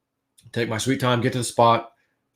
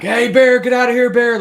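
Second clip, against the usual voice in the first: loud high shout to scare off a bear; a retold, fully shouted warning to an unseen bear; yelling at a bear; 12 semitones higher, 7 dB louder than usual. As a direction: bellow it out, much higher and louder. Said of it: "And then I start yelling".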